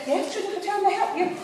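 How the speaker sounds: high-pitched posh receptionist; posh phone voice. Retold or imitated. Receptionist replying to a caller, high and posh. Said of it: "I decided I'd be rather posh".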